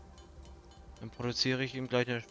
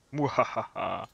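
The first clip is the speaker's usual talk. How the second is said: evil chuckle; 3 dB louder, slower than usual. Pretend. Playful villain laugh in game.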